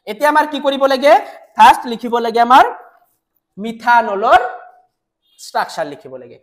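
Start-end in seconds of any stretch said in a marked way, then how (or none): none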